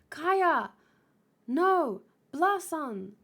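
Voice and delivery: high, loud cries of alarm. Acted. Crying out to Kaya in danger, alarmed. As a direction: cry each name out in alarm. Acted cry as Kaya is endangered.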